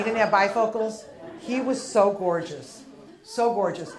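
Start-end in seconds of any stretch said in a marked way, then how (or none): none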